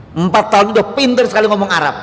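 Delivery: ordinary delivery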